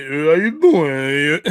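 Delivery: slurred, drawn-out boxer greeting; Rocky impression. Shown as a demonstration. Showing viewers a little bit, slow Rocky impression. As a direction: drag it out like a punchy boxer. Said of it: "Do Rocky. I can do a little bit".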